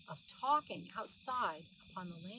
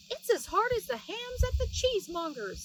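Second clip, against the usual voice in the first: much higher, louder squeaky voice; Hunca Munca; 10 semitones higher, 6 dB louder than usual. Acted. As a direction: squeak it like a tiny cross mouse wife.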